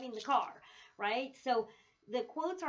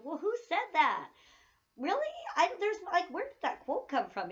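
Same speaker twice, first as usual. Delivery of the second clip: higher, louder rhetorical disbelief; a teacher hamming up disbelief at an unsourced quote; mock outraged surprise; 8 semitones higher, 6 dB louder than usual. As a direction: act shocked that nobody says who said it.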